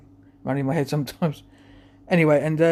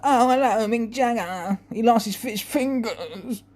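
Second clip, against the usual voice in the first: high, drawn-out singer caricature; 7 semitones higher, much slower than usual. Acted. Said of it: "I used to do, like, [name]".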